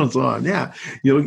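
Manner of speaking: ordinary talk, nothing marked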